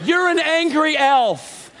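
put-on teasing baby voice to the angry driver; mock sweet taunt.

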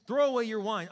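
an angry priest orders her, loudly; stern demand. A narrator's reading. Eli ordering Hannah to stop drinking, stern and loud. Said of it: "he demands".

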